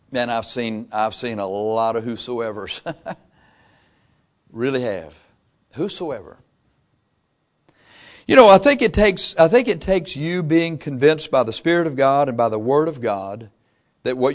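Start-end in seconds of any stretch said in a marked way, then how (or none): none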